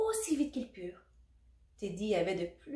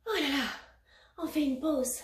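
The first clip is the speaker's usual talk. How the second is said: higher, mildly raised call; cried out; 5 semitones higher than usual. A narrator's reading. Hungry Teddy to the dancers, mild cry. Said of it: "cria Teddy l'ourson".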